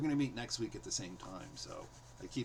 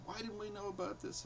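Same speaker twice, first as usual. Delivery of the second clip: upset; 10 semitones higher, 4 dB quieter than usual. Retold or imitated. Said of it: "they were so upset".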